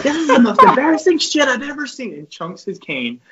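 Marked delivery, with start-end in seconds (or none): 0.0-2.1 high, loud mock coach yell; put-on coach tirade, pitched way up; Coach Garrido. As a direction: imitate an exasperated coach yelling at the team. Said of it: "listening to him yell at us".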